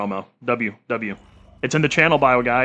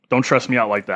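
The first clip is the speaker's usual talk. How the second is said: ordinary delivery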